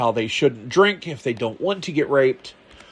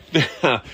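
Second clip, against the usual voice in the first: laugh. Real, not acted. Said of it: "gonna bust out laughing".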